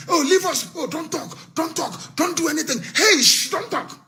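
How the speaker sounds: mock begging. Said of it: "spent two thousand years begging".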